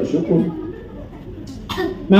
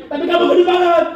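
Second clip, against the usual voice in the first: high mocking jab through laughter; laughing taunt; 12 semitones higher, faster than usual. Bully laughing at the fat boy, high and mocking. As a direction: tease him with a laugh.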